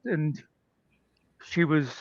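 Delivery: ordinary delivery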